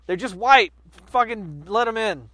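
loud, high opener settling into fed-up concession; exasperated giving up. Acted. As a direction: throw up your hands and concede. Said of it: "they went".

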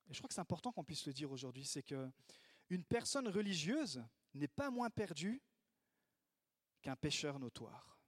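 usual speaking voice

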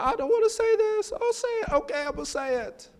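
very high, soft, squirming voice; tempted inner voice. Acted. Preacher acts out being tempted to speak, squirming. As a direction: squeak it quietly, giving in to temptation.

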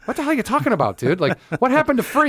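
high-pitched baffled complaint; indignant protest. Retold or imitated. Comic querying bartender about vanished free beer, high indignant protest.

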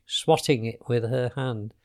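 ordinary delivery

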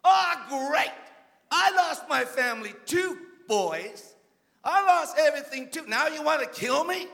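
acting out an aggrieved leader, voice jumping up; exasperated David. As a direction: protest indignantly as the wronged man. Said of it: "Could you imagine David?".

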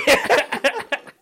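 a high, loud cackle of laughter; high burst of laughter. Real, not acted. Reacting to the spit take.

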